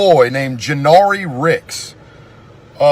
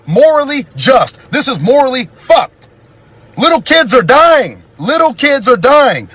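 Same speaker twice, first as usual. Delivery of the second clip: furious rant; 4 semitones higher than usual. Real, not acted. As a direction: hammer every point in real anger. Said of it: "I'm so fucking mad".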